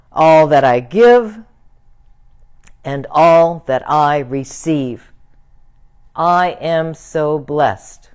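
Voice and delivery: slow, deliberate affirmation with pauses after each phrase; slow recitation. Real, not acted. Minister leading congregation in the giving blessing, slow recitation. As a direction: say it slowly, firmly projected, for others to repeat. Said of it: "I'll say it slowly".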